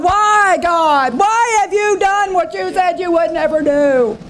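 high, pleading, accusing cry to God; Joshua blaming God. Acted. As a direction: whine up at heaven like a wronged man. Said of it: "He immediately begins to blame God".

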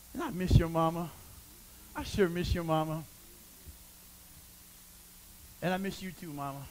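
low, soft, heartfelt longing; tender aside. Real, not acted. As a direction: let it sink quietly, with long pauses. Aside to the late friend's mother.

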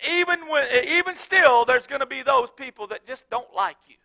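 ordinary talk

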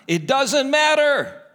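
loud, high, drawn-out dismissal; emphatic rebuttal. Real, not acted. Preacher overrules a reluctant believer's excuse, loudly emphatic. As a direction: cut off the excuse, high and stretched.

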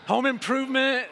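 joking aside to the ladies; playful. Real, not acted. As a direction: toss out the title with a grin. Joking aside about analogy.

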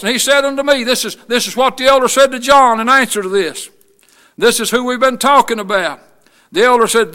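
normal talking voice

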